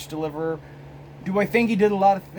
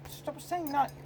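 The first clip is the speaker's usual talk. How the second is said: high-pitched, softer complaint; mock exasperated plea; 12 semitones higher, 9 dB quieter, slower than usual. Briton begging Hitler to stop saying no, mock exasperated. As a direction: squeak out a hammed-up British protest.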